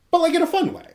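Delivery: sudden high playful lift; a bright teasing high note; playful squeak. Real, not acted. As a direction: squeak it out with glee.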